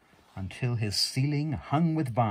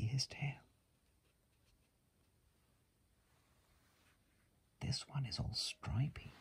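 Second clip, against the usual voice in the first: whisper; 13 dB quieter than usual. Real, not acted. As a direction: whisper it gently over the book.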